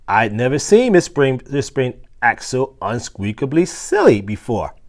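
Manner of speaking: plain reading voice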